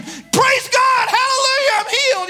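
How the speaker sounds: high, loud hallelujah shout; acted scream. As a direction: cry out like someone suddenly healed. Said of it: "you hear people scream".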